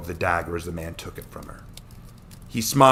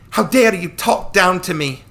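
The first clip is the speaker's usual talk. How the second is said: high, loud indignant shout; a young woman's outraged yell; 11 semitones higher, 9 dB louder than usual. Acted. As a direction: hurl it back at him, offended. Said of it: "Lisha shouted".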